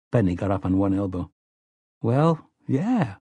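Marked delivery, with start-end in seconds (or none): 2.0-3.2 higher, mildly exasperated "obviously" tone; stating the obvious. A narrator's reading. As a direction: answer as if the question were silly. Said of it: "the tone implying, Sheesh, what else".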